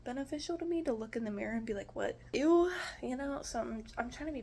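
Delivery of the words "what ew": a quick high "What? Ew" of playful disgust; mock disgust. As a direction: recoil at the mirror, half joking. Disgust at own reflection.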